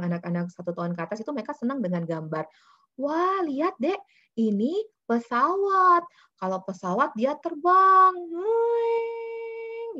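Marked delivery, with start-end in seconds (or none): 3.0-8.1 put-on high, loud, slow wonder for a toddler; excited parent. Shown as a demonstration. Showing a toddler a plane card. 8.1-10.0 high whooshing plane sound. Shown as a demonstration. Imitating plane noise.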